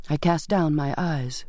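ordinary delivery

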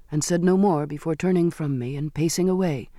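ordinary delivery

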